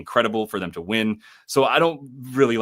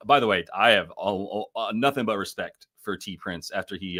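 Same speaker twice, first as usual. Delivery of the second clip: usual speaking voice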